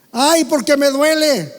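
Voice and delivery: slow, loud "Ay" of pain; demonstrated cry of pain. Shown as a demonstration. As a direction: cry "Ay" slowly, drawing out the hurt. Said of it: "díganlo fuerte".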